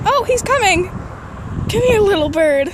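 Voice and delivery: high, gentle coaxing; coaxing a wild bird. Real, not acted. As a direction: sweet-talk a shy little bird. Calling softly to a bird.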